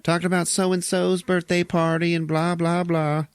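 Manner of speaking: high-pitched put-on gossip voice; mock chit-chat. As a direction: parody empty salon small talk. Mocking salon small talk.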